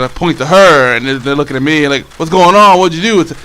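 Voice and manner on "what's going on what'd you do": raised, louder urgent questioning; accusing classmates. Retold or imitated. Classmates confronting him about the teacher, agitated. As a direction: imitate kids demanding answers. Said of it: "they're, like, talking shit".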